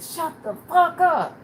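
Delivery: high, slow, loud holler; furious retold shout. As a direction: yell it across the cell block. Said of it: "I started hollering".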